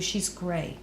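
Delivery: ordinary delivery, nothing marked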